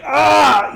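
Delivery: loud high pained grunt; a sudden strained cry as the body changes; transforming grunt. Acted. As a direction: Edmund grunting as he grows taller. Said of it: "hear him grunt".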